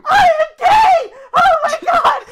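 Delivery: very high, loud drawn-out squeal; hammed-up overwhelmed shriek, slow and piercing; Salvador's ecstatic shriek. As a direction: scream the revelation in falsetto. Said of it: "Salvador's in the back like".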